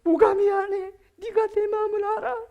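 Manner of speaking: wounded sister screaming back at the apology; furious victim. Acted. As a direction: scream it through tears of rage. Acting the hurt victim.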